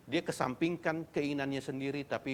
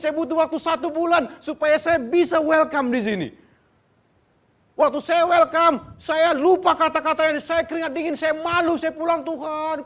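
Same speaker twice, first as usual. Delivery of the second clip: much higher and louder, fervent sermon shouting; full-force preaching; 13 dB louder than usual. Acted. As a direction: preach it out with all your fire. (berkhotbah dengan berapi-api, tinggi dan keras)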